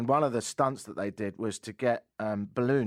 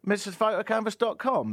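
higher emphatic brand name; ad read; 12 semitones higher, 4 dB louder than usual. Acted. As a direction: sell the name loudly. Ad name repeated.

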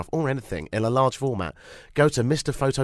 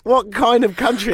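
laughing; 11 semitones higher, 8 dB louder than usual. Real, not acted. Laughing at the caller.

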